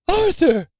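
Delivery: very high, shocked cry of his name; horrified scream. Acted. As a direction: scream at the sight in the stall. She finds him dead.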